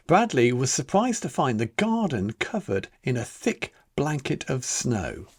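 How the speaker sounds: ordinary delivery, nothing marked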